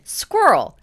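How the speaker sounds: American accent, "squirrel" with a hard, clear R. Shown as a demonstration. Showing friends her American accent "squirrel". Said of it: "If I say it in an American accent".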